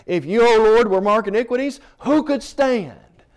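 crying out the verse. Acted. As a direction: proclaim it like a plea to the Lord. Climactic scripture proclamation.

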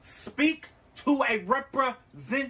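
slow. Acted. Repeating to phone menu.

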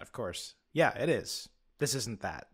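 ordinary talk, nothing marked

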